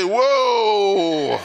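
a loud, startled whoa at an ugly newborn. Retold or imitated. Preacher imitating people recoiling from a newborn, loud startled whoa. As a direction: recoil at the sight of the baby.

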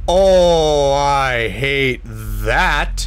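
groaning I really hate this; slow, dragged-out complaint; drawn-out disgust. Real, not acted. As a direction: stretch every word out slowly in disgust. Hated horror game moment.

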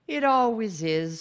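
mildly weary, sighing reply; resigned sigh. A narrator's reading. Gladys answers Martha's worry about what awaits, sighing. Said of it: "sighed Gladys".

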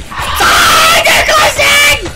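crying out in real fear; terrified shout. Real, not acted. Player cornered by monsters, panicked yell.

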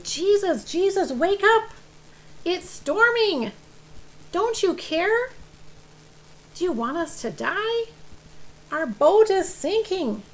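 loud, high, urgent pleading; panicked disciples, acted for young listeners; alarmed cries. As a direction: cry out to wake a sleeper as the boat floods. Disciples waking Jesus in panic.

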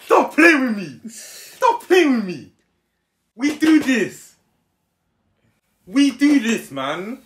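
high, slow, emphatic cry; worked-up protest. Real, not acted. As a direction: stretch each phrase out in a high protest. Protests that others doubt his jokes.

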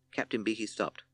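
ordinary delivery

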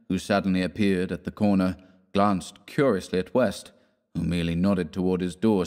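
usual speaking voice

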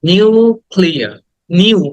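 slow "নিউক্লিয়ার" with a long drawn "niuu"; stretched "u". Shown as a demonstration. Teacher models for students, "নিউক্লিয়ার" with its "ইউ" drawn out. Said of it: "ইউটাকে টেনে বলতে হবে".

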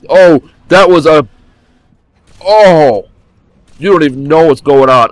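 loud, high startled cries. Real, not acted. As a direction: caught off guard mid-fight. Startled by enemy attack.

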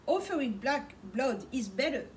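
usual speaking voice

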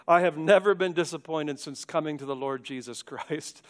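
usual speaking voice

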